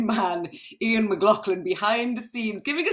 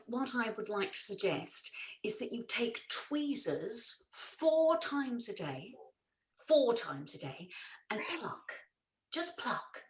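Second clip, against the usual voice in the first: much softer, murmured, whispered; hushed adviser; 9 dB quieter than usual. Acted. Adviser murmuring chin-plucking tips, soft hush. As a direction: breathe the advice softly.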